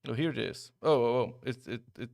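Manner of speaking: ordinary delivery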